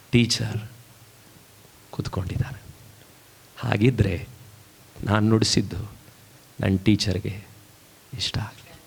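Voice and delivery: low, halting, fading to a near whisper; acted hushed plea in a low pitch; subdued longing. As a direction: let each phrase hang, then almost vanish. Violinist longs for teacher's approval.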